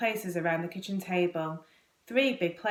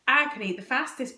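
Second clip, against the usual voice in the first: higher-pitched boastful claim, opening loud; bragging boy; 6 semitones higher, 4 dB louder than usual. A narrator's reading. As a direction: brag like a competitive schoolboy. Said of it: "bragged Tom".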